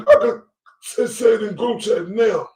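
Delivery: ordinary talk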